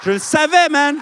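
loud, high gotcha cry; triumphant shout. Real, not acted. Comedian crowing at the crowd in a loud, high triumphant shout. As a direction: crow it like you called it all along. Comedian crows at guessed joke.

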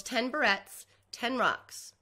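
usual speaking voice

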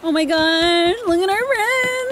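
climbing to a high squeal; high excited gushing; giddy delight. Real, not acted. Giddy at seeing the characters.

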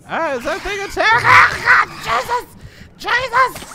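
panicked shriek; terrified scream. Real, not acted. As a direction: scream in sudden fright. Scared by a fish attack.

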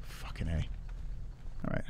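whispered under his breath; hiding from the monster, whispering; tense whisper. Real, not acted. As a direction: breathe it out without voice, keep it hushed. Hiding in a horror game.

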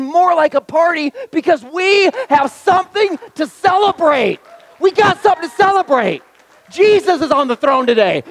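high, loud, driving celebration; preaching at full force. Acted. Pastor declaring church a celebration, loud and rising. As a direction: build it like a party cry.

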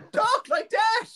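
mocking, sky-high squeak; absurdly high voice. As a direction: pitch it comically high. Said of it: "It's always this high".

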